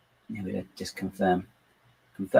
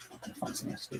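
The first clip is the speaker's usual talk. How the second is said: soft whisper to herself, much softer; whispered aside; 16 dB quieter, much faster than usual. Real, not acted. Builder admitting to herself she is distracted, whispered. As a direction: whisper it like a guilty confession.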